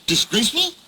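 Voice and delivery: indignant. Acted. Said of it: "said Gordon".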